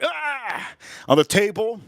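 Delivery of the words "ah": high, softened imitation of a mad scream; mock angry scream. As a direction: mimic a furious kid's yell at half volume. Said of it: "he gets mad and he's like".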